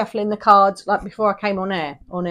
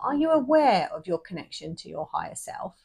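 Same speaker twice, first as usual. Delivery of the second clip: normal talking voice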